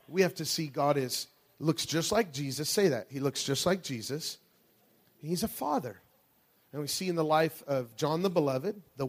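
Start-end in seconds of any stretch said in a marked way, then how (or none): none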